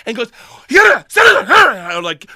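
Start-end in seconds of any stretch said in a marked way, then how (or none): 0.7-1.7 high, loud mock foreign shouting; yelled order. Cop pointing at student mid-argument, yelling. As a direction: yell garbled commands. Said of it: "in the middle of the yelling".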